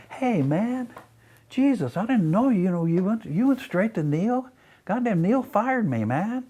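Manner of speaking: high-pitched mock pleading voice; imitated aggrieved guy. Fired guy's retold complaint to him.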